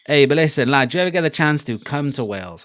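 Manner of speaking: ordinary talk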